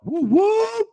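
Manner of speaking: excited whoop. Real, not acted. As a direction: cheer for the married couples out there. Shout out to married couples.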